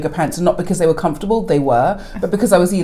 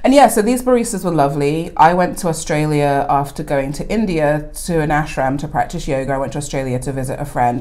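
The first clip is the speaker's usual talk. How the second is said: ordinary talk, nothing marked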